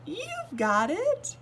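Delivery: high slow giggly cheer; Little Miss Sunshine giggles as the king learns; giggling. A narrator's reading. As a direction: giggle it out happily. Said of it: "she giggled".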